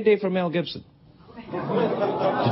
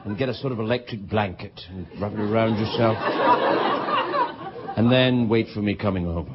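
mock seductive; 8 semitones lower than usual.

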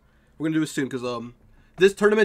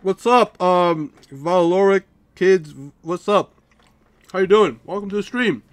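muffled mouth full; 6 dB louder, slower than usual. Real, not acted. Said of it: "so that's why I sound a bit muffled".

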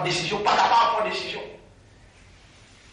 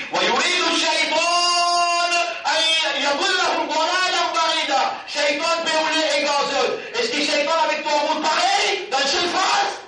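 preaching shout; 8 semitones higher than usual. Real, not acted. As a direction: call out the verse with full force. Prêcheur s'emporte en citant le verset. (prêche à pleine voix, plus aigu)

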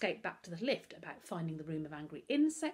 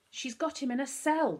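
angry; 8 semitones higher, 6 dB louder than usual. A narrator's reading. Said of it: "Darcus said angrily".

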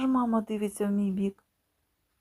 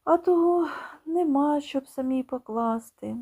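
higher, louder grudging aside; a sour little aside; aunt aside; 5 semitones higher, 4 dB louder than usual. Acted. Aunt grumbling she won't serve him herself, grudging. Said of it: "каже дядина".

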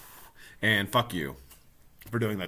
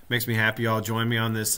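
ordinary delivery

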